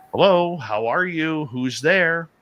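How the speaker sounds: loud, high, drawn-out hail; calling out. Retold or imitated. Calling to an unseen stranger in the trees, calling out. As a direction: holler slowly to whoever is out there.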